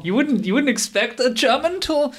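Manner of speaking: high mock-innocent disbelief; sarcastic. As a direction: ask it with heavy irony, high.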